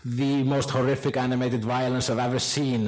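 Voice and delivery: normal talking voice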